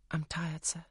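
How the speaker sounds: tired, breathy whisper. Acted. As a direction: whisper it, worn out. Weary governess excuses herself.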